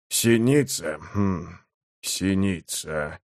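low, hushed, slow murmur to oneself; stunned musing. Acted. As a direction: let the name sink in, very softly.

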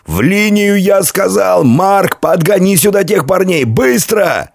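high-pitched, insistent military orders; commanding shout. A narrator's reading. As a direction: drive the men into line. Commander repeats orders to men.

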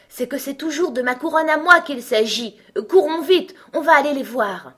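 high, loud, eager urging; rally them to come and look; excited king. A narrator's reading. King urges Alice to run and watch the fight, excited.

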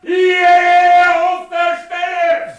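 roaring at full force; furious shout. Acted. Husband roaring for his wife in the tavern, furious shout. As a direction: bellow the order across the whole inn.

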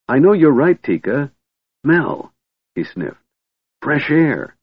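a kender pulling Tika toward fresh air; excited. A narrator's reading. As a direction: gush out the plan eagerly. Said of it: "Tass yelled in excitement".

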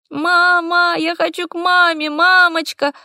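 put-on high, wailing small child; crying little girl. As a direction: wail for mama like a small child.

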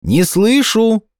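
high, slow stretched call. A narrator's reading. Hedgehog can't hear the hare, calling up. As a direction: holler slowly to someone far overhead.

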